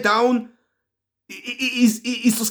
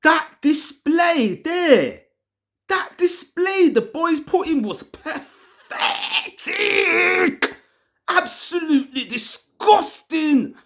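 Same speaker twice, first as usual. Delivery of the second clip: angry disgust; 7 semitones higher than usual. Real, not acted. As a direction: spit out your disgust at the team. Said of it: "And I'm angry right now".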